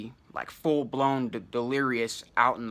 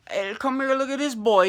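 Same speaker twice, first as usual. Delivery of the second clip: mocking bystander; 9 semitones higher than usual. Imagined bystander calling friend.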